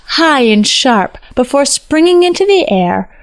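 ordinary delivery

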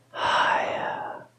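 soft, airy whisper with long pauses. A narrator's reading. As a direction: whisper it as an insistent voice in his ear. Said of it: "It only says".

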